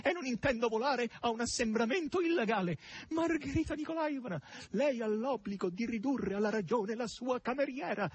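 high, insistent complaint; pompous, desperate high-pitched appeal; desperate pleading hog. A narrator's reading. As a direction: protest formally in a high, beseeching whine. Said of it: "con tono fra il disperato e il supplichevole".

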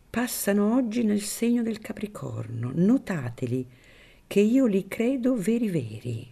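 put-on a wary old priest keeping his distance; Don Cirillo, wary priest.

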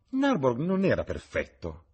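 high-pitched protest; exclaiming. A narrator's reading. Old lady speaking of her husband, exclaiming. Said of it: "esclamò la vecchia signora".

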